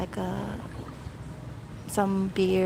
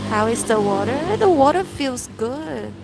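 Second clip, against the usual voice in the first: excited; 4 semitones higher, 6 dB louder than usual. Real, not acted. Asks and answers about water.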